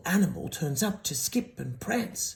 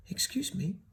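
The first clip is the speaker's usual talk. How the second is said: soft whispered excuse me; polite cough; 4 semitones higher, 5 dB quieter than usual. A narrator's reading. Cricket gently interrupting Gerald, soft whisper. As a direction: clear your throat politely. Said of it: "coughed a cricket".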